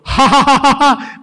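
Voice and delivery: very high and loud hammed-up laughter; the whole church roaring a fake laugh; the entire church laughing on command; mock mass laugh. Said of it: "the entire church will go".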